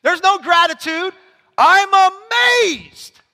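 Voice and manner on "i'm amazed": high, slow, loud astonished Paul; shocked Paul. Retold or imitated. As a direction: act out Paul's shock, drawn out and big. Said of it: "That's what he's doing here".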